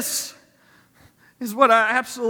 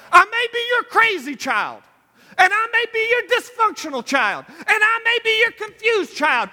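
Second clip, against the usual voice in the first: frantic prayer; 8 semitones higher than usual. Acted. Preacher voicing a sinner's frantic prayer, high and pleading. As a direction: cry out to God like a desperate child.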